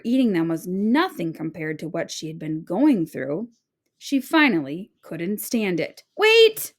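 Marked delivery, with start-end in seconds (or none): 6.2-6.7 much higher, louder call; calling the old woman back; desperate cry. A narrator's reading. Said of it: "she cried".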